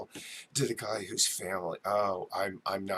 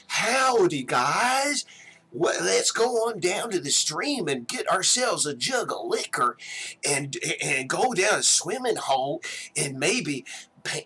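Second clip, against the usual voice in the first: put-on huckster Southern accent; 9 semitones higher, 7 dB louder than usual. Mocking how TV would portray Nashville characters, in a huckster accent. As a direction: put on an utterly over-the-top Tennessee huckster accent. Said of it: "this utter huckster accent".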